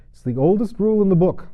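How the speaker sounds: high, loud, huffy royal protest; indignant king. A narrator's reading. King to Alice, blustering over his invented rule. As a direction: bluster to cover the lie.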